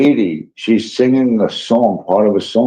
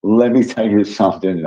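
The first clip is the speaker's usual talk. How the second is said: normal talking voice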